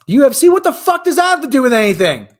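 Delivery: loud, high-pitched disbelief; incredulous shout at high pitch; exasperated outburst. Real, not acted. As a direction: shout it in real bafflement. Baffled outburst at played clip.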